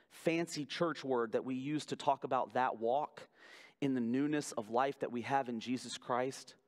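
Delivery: ordinary delivery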